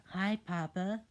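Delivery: put-on high, loud kid greeting; sweet child voice. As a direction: greet papa like a little kid.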